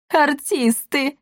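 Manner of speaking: high, drawn-out cry of admiration; delighted. A narrator's reading. Plumed-hat spirit greeting artists, delighted. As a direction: draw it out in rapture. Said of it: "восхищённо захлопал в ладоши дух".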